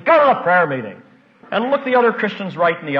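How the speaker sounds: loud, emphatic preacher's urging; forceful exhortation. Real, not acted. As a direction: drive the command home loudly.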